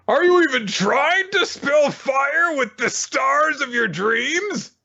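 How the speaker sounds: high, slow, shouted captain's challenge; hammed-up sports captain yelling. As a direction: bellow it like a fired-up captain.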